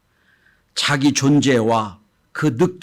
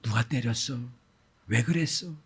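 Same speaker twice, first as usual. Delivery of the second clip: soft, calm asking; gentle parent; 2 semitones lower, 8 dB quieter than usual. Retold or imitated. Parent softly asks the beaten child, at eye level. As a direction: kneel down and ask kindly. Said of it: "눈높이를 맞춰 이렇게 말합니다".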